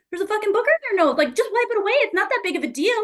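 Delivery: high, fast exasperated rant in her head; exasperated inner thought. Retold or imitated. Stuck staring at the booger, high exasperated rant. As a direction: blurt it out, can't stand it.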